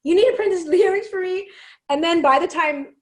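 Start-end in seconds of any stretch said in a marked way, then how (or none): 0.0-1.5 excited call. Retold or imitated. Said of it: "So I was like".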